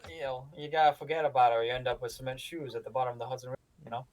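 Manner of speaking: fast New York mobster patter; New York accent. Acted. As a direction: rattle it off like a New York wiseguy. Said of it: "Should be talking more like this".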